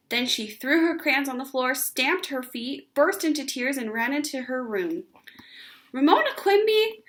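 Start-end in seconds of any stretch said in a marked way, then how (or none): 5.9-7.0 much higher, louder stern call of her full name. Acted. Father calling after his daughter, stern. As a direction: call her back to order. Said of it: "her father said sternly".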